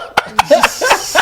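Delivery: breathy high-pitched laughter. Real, not acted. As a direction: burst out laughing. Laughing at the ladder story.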